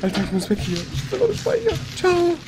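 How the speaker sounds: anxious. Real, not acted. Fleeing in panic.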